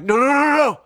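high, loud rapid-fire refusal; panicked pleading. Acted. Storyteller facing the lover's parents, panicked begging. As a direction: beg loudly in mock panic, pitch way up.

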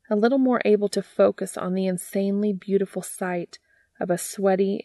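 ordinary delivery

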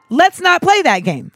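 deliberate Obama cadence; Barack Obama. Acted. Host lecturing the shop workers, slow Obama impression. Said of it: "I started talking like Barack Obama".

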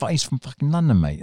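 loud, high-pitched cocky London lad; London teddy boy giving it all that; London accent impression. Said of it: "with this sort of London accent".

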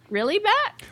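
high, rising mock disbelief; incredulous. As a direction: act out a sarcastic really. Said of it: "It's like".

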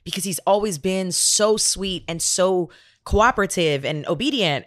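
ordinary delivery

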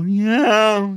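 high, loud falling wail; Paul Mescal. Acted. Mocking Mescal as falling from a window, high loud wail. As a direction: cry it out as if dropping from a window. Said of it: "sounds like he's falling out of a window".

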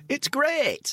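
high-pitched, over-keen sales pitch; mock tourist-ad cheer. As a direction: sell it like a hammed-up tourism advert. Mock tourist advert for Scotland.

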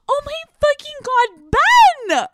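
hammed-up gushing reunion; overexcited squeal. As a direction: scream his name like a thrilled fan. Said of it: "You're not gonna be like".

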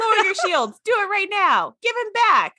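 high, pushy captain's commands, half joking; mock Riker orders. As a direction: bark the orders like a flustered captain. Voicing Riker's demands.

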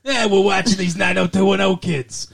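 mock Brooklyn angel; Brooklyn accent. Angels watching over the kids, hammed-up Brooklyn accent. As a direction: put on a cartoonish Brooklyn accent. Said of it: "with Brooklyn accents".

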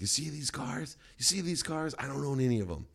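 high, showy mock-influencer voice; mock influencer pitch. To party guests, a high showy mock-influencer taunt. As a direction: play the show-off flipping it on them. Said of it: "I'm like".

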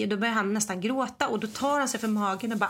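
ordinary talk, nothing marked

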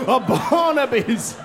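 mock fearful gasp. Comic imagining Barnaby's arrival, mock terror.

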